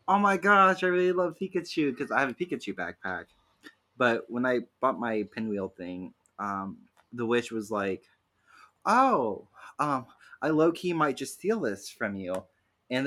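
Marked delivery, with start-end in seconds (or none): 0.1-2.0 gushing cashier. Retold or imitated. Cashier gushing over backpack. 8.8-12.4 playful witch. Retold or imitated. Witch teasing about pinwheel.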